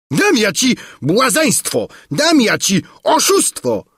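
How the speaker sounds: high, threatening royal roar; enraged roar. A narrator's reading. King Padella threatening the man who mocked him, enraged roar. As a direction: roar the threat, pitched right up. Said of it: "ryknął Padella".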